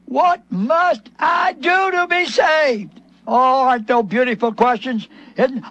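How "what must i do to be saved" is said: slow, high, desperate cry; the jailer's slow anguished cry. Retold or imitated. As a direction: plead it slowly like a terrified jailer. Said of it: "he cried out".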